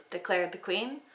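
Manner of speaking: ordinary delivery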